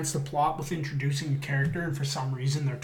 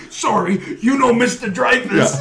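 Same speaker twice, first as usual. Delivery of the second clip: higher and louder, laughing; mock boss; 8 semitones higher, 10 dB louder than usual. Boss calling out to Dreyfus, laughing mock voice. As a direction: ham up the boss, half laughing.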